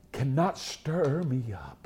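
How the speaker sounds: slow, whispered solemn drop; hushed. Real, not acted. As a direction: sink to a slow whisper for weight. Sermon climax drops to a hush.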